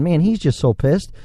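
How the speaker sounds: usual speaking voice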